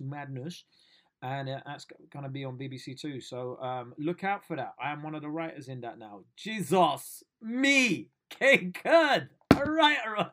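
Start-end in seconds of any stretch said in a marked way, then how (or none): 6.3-10.3 high, loud amazed outburst; excited disbelief. Real, not acted. Vlogger amazed at himself becoming a writer, excited outburst. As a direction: shout it out in delight.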